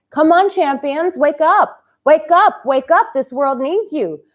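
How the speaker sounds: loud, high rallying cry; loud rousing call to her male viewers. Real, not acted. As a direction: wake the champions up.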